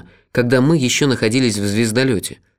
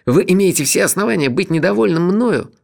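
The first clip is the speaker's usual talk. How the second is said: much higher, upset and anxious; agitated; 7 semitones higher than usual. A narrator's reading. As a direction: blurt out an anxious apology. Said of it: "сказал он, волнуясь".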